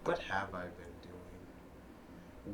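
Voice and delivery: usual speaking voice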